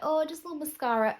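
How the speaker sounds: high, quick mock of people; mocking quote. Vlogger mocking people who wear only mascara, high and quick. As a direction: toss it off like a dismissive know-it-all. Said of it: "when people are like".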